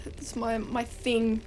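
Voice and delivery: ordinary talk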